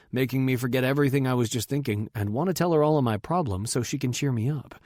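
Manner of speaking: ordinary delivery, nothing marked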